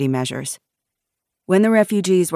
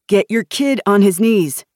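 raised, higher voice of command; forceful demand; 5 semitones higher, 7 dB louder than usual. A narrator's reading. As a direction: demand it loudly. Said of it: "Jason demanded".

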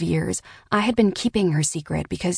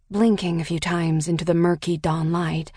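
plain reading voice

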